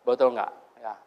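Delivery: ordinary talk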